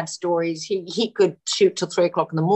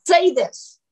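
high-pitched attempt at a Brooklyn accent; Gary Marshall; 10 semitones higher, 3 dB louder than usual. Director feeding a joke mid-scene, attempted Brooklyn accent. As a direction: call out in badly faked Brooklyn. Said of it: "his Brooklyn accent, which I cannot do".

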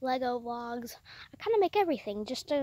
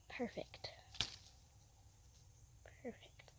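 soft unvoiced whisper; 18 dB quieter than usual. Real, not acted. Kid whispering behind the camera, hushed. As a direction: breathe the word out so the cat stays still.

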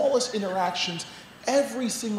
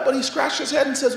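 ordinary talk, nothing marked

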